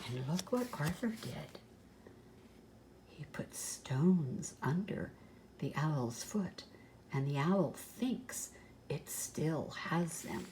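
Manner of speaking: soft, low hushed telling; whispering. Real, not acted. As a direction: keep it secret from the owl. Hushed aside to children.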